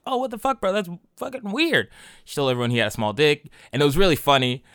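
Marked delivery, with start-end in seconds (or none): 0.1-1.9 high, incredulous squeal of outrage; shocked disbelief. Retold or imitated. Telling the friend he slept with his girl, shocked high voice. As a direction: voice jumps way up in mock shock.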